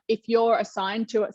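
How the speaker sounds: ordinary delivery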